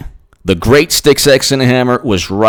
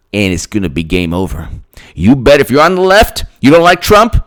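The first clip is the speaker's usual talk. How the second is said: ordinary delivery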